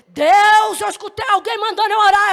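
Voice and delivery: high, loud urgent exclamation; loud insistence. Retold or imitated. Girl insists to the older woman, loud and high. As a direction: cry it out to be believed.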